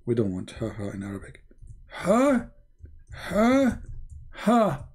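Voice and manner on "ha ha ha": high, separated "ha" syllables; mock devil laugh. Shown as a demonstration. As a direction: spell out Satan's laugh, high and deliberate. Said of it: "This is Shaitan in your mouth".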